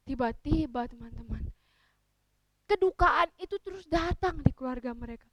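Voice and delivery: usual speaking voice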